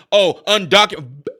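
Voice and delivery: scornful "undocumented", said as if distasteful; mocking the term. Vlogger mocking the word undocumented, scornful. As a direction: sneer at "undocumented". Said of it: "you're not gonna make me say".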